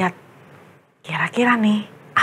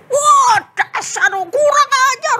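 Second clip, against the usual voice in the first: high, loud insulting shout; angry gamer yell; 6 dB louder than usual. Acted. Boy yelling at his game, furious shout. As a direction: snap at teammates mid-game.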